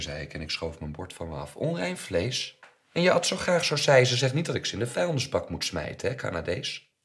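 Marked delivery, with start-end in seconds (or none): 1.6-6.9 high, loud outraged scolding; indignant mother. A narrator's reading. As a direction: throw it back at the child, scandalised. Mother outraged at refused meal. (verontwaardigde, scheldende moeder)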